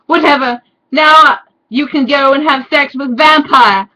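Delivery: mock teen yell; hammed-up shout. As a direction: hurl it at the boyfriend like a teen. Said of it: "I shouted".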